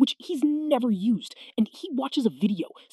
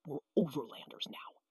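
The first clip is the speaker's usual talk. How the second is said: a hint of a solemn dad, softer; wise dad; 7 semitones lower, 12 dB quieter than usual. Kid imitating dad musing by the campfire. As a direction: say it like a fireside sage. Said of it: "and goes, Dad, he's".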